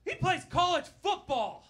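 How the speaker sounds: high, loud defensive shout; shrill bragging outburst; proud mom shout. Retold or imitated. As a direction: yell it like a proud mom defending her son. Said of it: "my mom looks at him and goes".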